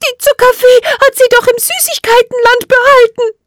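very high, loud little fairy voice; tiny fairy crying out in disbelief; desperate cry. A narrator's reading. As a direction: protest in high-pitched distress. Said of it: "rief sie verzweifelt".

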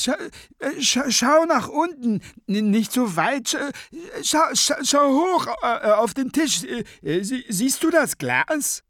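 very high, loud, spluttering voice; frantic high-pitched head in a jar; flustered stammering Scapegrace. A narrator's reading. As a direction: stumble urgently through the directions. Scapegrace directs her to the jar.

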